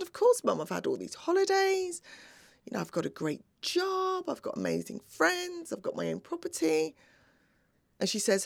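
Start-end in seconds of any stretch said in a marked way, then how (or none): 0.0-7.0 high-pitched listing of proofs to Mum; reenacted daughter's protest, raised pitch; retold assurance. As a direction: insist lightly, pitched well up, as if obvious. Daughter reassuring her questioning mother.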